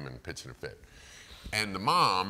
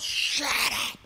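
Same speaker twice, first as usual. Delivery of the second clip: louder mock yell of shut up; yelling toddler; 6 dB louder than usual. Toddler leans forward and yells at mom, loud. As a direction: snap it at mom like a furious toddler. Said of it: "just yells".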